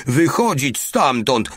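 high, slow, bellowed police command. A narrator's reading. Mały shouting at the empty guardhouse, full yell. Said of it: "darł się jeszcze głośniej niż poprzednio".

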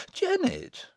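very high, embarrassed yelp; shocked exclamation. Acted. Embarrassed sister hushing Jennet, high shocked exclamation. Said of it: "exclaimed Alizon".

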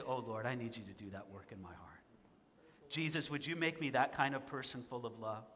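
a soft whispered-down plea; quiet prayer. Acted. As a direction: pray it softly, pleading. Praying aloud, quiet.

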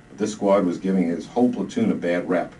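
ordinary talk, nothing marked

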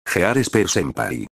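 ordinary delivery

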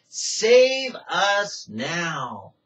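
very slow Save us now; crying out. Shown as a demonstration. Preacher showing Hosanna as a cry to be saved, very slow. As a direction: drag out Save us now as a plea. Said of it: "it's crying out".

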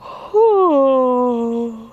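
long, loud open yawn; exaggerated yawn sound; demonstrated yawn. Shown as a demonstration. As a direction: let out a big drawn-out yawn, falling in pitch. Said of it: "Yawning is".

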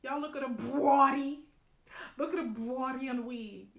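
loud, excited call to viewers; loud excited gush. Real, not acted. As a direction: show it off loudly. Excited showing the wig.

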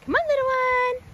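high sing-song coaxing; squeaky coaxing a dog. Real, not acted. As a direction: call the puppy in a high voice. Coaxing the puppy.

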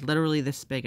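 ordinary talk, nothing marked

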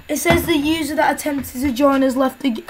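ordinary talk, nothing marked

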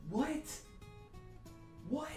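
high disbelieving what; shocked. Pretend. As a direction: squeak it out in shock. Shocked reaction.

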